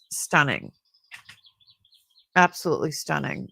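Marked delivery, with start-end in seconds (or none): none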